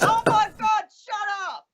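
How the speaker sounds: retold cry of being fed up; mock exasperation. Medium to pestering spirits, high-pitched exasperation.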